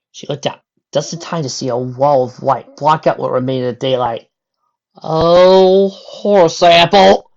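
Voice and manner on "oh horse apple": loud, drawn-out alarmed curse; alarmed exclamation. A narrator's reading. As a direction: gasp it out at the avalanche. Applejack sees avalanche.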